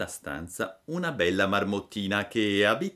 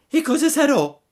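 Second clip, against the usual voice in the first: high, loud startled puppet; Pinocchio alarmed; 10 dB louder than usual. A narrator's reading. Pinocchio startled by the news, high and loud. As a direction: jump up in alarm.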